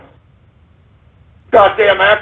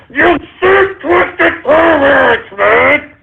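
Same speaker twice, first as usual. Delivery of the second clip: shouting; much slower than usual. Acted. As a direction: yell it out furiously. Same rant, now shouted.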